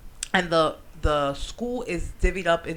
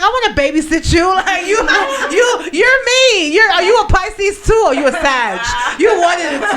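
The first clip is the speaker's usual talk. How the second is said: high, loud, laughing gush; giddy and loud, laughing through it; gushing excitement; 9 semitones higher, 11 dB louder than usual. Retold or imitated. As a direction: squeal with delight at the kid. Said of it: "in my mind, I was like".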